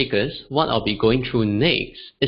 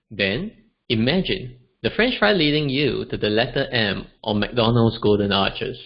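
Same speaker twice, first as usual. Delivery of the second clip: normal talking voice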